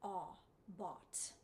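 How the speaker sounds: bought with an open aw vowel. Shown as a demonstration. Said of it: "we have an open O".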